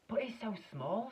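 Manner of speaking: high, slow aghast cry; father shocked at his tiny son; dismayed shout. A narrator's reading. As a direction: gasp it out in dismay. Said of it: "shouted Mister T V".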